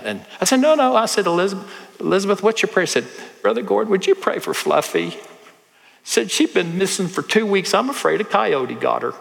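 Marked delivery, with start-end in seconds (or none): 0.6-2.8 put-on high, gentle coaxing to a shy child; the pastor encouraging a shy five-year-old to speak, high and gentle; coaxing a little girl. 3.4-9.1 a light, higher imitation of a small girl; a hint of a little girl asking about her lost cat. Pastor voices five-year-old girl.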